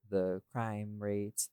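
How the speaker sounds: ordinary talk, nothing marked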